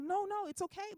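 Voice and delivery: high-pitched, hurried-to-soothe mock reassurance; placating. Comic soothes her offended butt pad, placating.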